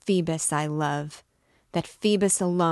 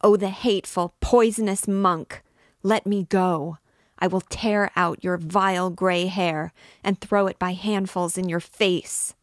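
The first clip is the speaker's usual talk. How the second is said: put-on loud, higher shriek of a girl fighting him off; 3 semitones higher, 6 dB louder than usual. A narrator's reading. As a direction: scream it at him, furious and terrified. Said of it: "she shrieked".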